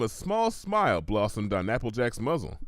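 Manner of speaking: usual speaking voice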